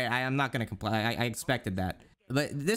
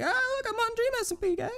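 much higher, put-on squeaky fan voice; mocking fan voice. Mocking a fan bragging about Dream SMP, squeaky put-on voice. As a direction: ham up a starstruck fan. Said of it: "I'm like".

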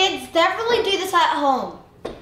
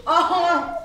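gasping from a spicy burn; real yelps of heat and laughter; mouth on fire; much slower than usual. Real, not acted. After eating the spiciest one.

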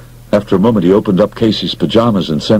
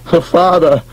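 chortling; 10 semitones higher than usual. Acted. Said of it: "McGarry chortled".

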